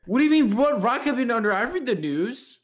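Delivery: high, loud offended protest; indignant disbelief. Real, not acted. Indignant, much higher and louder.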